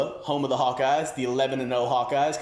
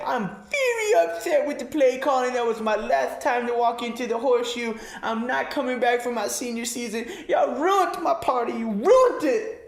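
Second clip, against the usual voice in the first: high, whiny fake sob of a sulking star; mock crying athlete; 10 semitones higher than usual. Star running back sobbing to the coaches, high whiny mock cry. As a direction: wail it like a spoiled player crying to the press. Ezekiel Elliott mock whining complaint.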